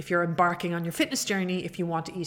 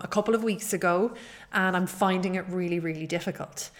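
ordinary talk, nothing marked

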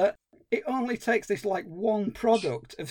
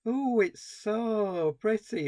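slow, drawn-out wondering ooh; lightly voiced child in awe; a hint of a dreamy kid; mock weird kid; 2 semitones higher, slower than usual. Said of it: "say lines like".

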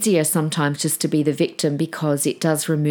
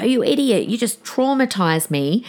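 mock angry outburst; 5 semitones higher than usual. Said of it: "he might be really mad".

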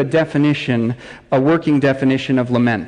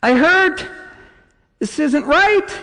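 put-on high, wailing child's cry; mock child wailing to mom; hurting child; 12 semitones higher than usual. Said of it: "this child is screaming out".

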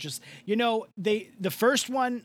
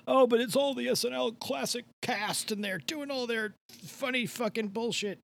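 higher, mocking snob impression; mocking fan voice; 6 semitones higher than usual. Mocking the fans who defend Caddyshack, sneering.

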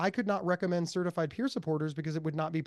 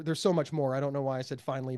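ordinary talk

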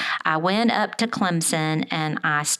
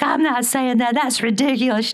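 put-on higher, louder teen pushback; arguing son; 6 semitones higher, 4 dB louder than usual. Son refusing mom's mantra in the car, irritated and raised. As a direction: toss it back at mom like it's absurd. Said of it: "he's arguing with me all the way".

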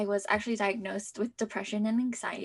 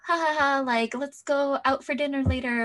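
higher, louder mock laugh into breezy chatter; carefree friends; 5 semitones higher, 6 dB louder than usual. Friends making dinner plans beside a panicking student, breezy imitation. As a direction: lightly imitate friends chatting without a care.

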